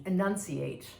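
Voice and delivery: "enunciate" drawn out slowly, every syllable distinct; slow, precise "enunciate"; careful enunciation. Shown as a demonstration. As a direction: sound out each syllable of "enunciate" with care. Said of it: "how to speak with in-, clarity".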